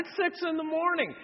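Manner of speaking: ordinary talk, nothing marked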